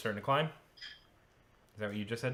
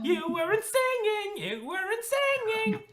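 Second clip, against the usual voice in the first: very high, singsong creature squeal; taunting game creature; 4 dB louder than usual. Acted. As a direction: scold them in a shrill little creature voice. Game master voices taunting creature Runcible.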